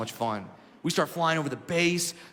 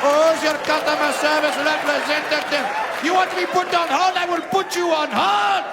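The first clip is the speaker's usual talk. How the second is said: put-on much higher, loud yelling Indian accent; Indian accent shouting; 10 semitones higher, 6 dB louder than usual. Indian man shouting at woman in high Indian accent.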